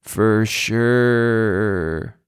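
very slow, stretched-out mimicry; long, lazily stretched mock reply; drawn-out imitation. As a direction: imitate him dragging out both words. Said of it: "is Zay going".

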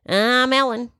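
high, lilting celebrity talk-show host parody; Ellen. Acted. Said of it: "I do sound like Ellen".

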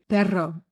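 "perro" with a rolled R, slow. Shown as a demonstration. Demonstrating for Brent, "perro" with rolled R's. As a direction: roll the double R in "perro". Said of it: "Try to get Brent to roll his R's".

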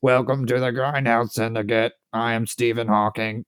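flat synthetic speech voice; monotone computer voice; Stephen Hawking voice. Retold or imitated. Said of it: "I need that Stephen Hawking voice".

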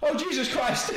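imitating an Irish exclamation, high and loud; mock Irish shocked outburst; Irish accent. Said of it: "in his beautiful Irish accent".

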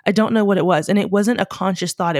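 normal talking voice

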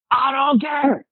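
very high-pitched mock professor yell; imitated yelling. Student imitates professor refusing to listen, high mock yelling. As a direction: shout over the student, imitating an irate professor. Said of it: "he was yelling under that basement".

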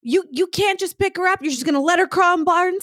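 imitating an exasperated parent; mock scolding parent.

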